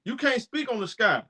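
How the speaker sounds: lightly voiced snide fan telling him off; mocking critic. Host imitates fans who say he can't speak, in a high mocking voice.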